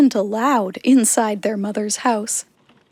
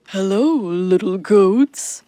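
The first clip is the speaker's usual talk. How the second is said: deep wolf voice; 3 semitones lower than usual. A narrator's reading. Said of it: "said a deep voice".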